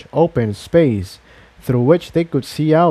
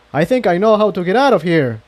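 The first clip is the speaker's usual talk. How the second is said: fast, higher, urgent announcement; excited shout; 7 semitones higher, faster than usual. Acted. Jigsaw telling the mares he has found a way out. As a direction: rush it out with sudden hope. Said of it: "he shouted".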